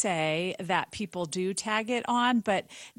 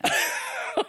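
light laugh. Real, not acted. Laughs about Boston.

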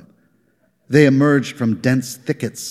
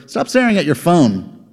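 scolding coworker; 7 semitones higher than usual. Acted. Rogler scolds Ebling.

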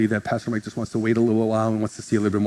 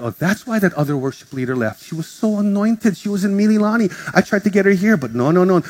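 higher, mocking quote of church gossip; 7 semitones higher than usual. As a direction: mimic the whispering insiders.

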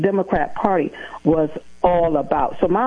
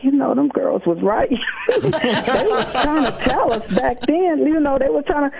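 higher, laughing through the words; amused family voice, pitched up with a laugh; family admitting laughing; 9 semitones higher than usual. Retold or imitated. Family conceding the girls were right, laughing higher.

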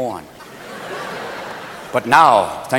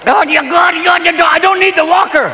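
put-on high, hollered old man's outburst; sputtering high-pitched elation; excited grandpa; 8 semitones higher than usual. Acts out excited grandpa on Viagra.

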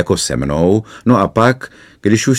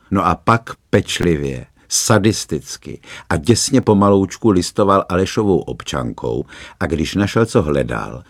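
ordinary delivery

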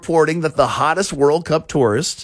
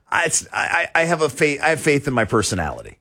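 ordinary delivery

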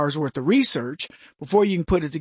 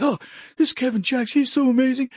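higher, gushing fan voice; mock fan gush; 6 semitones higher than usual. Host imitates listeners praising him, higher and gushing. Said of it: "you're like".

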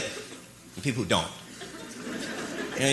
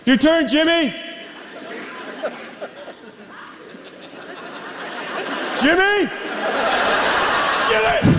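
calling out; 11 semitones higher, slower than usual. Pretend. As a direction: yell across the yard for Jimmy. Calling a kid to throw.

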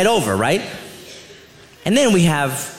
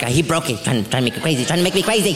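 imitating mother-in-law; 4 semitones lower, faster than usual. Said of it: "She blames everything on me".

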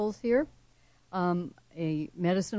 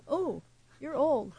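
mock pity; 8 semitones higher than usual. Guest mimics how people treat aging as a flaw, high mock pity. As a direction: coo it high, as a teasing put-down.

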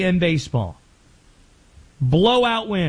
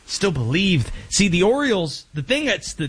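ordinary talk

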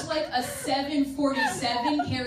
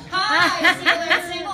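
loud flirty intro; 5 semitones higher, 9 dB louder than usual. Acted. Playful self-introduction, louder.

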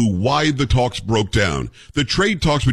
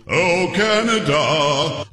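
mock Canada call; 5 semitones higher, much slower than usual. Shown as a demonstration. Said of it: "I keep on going".

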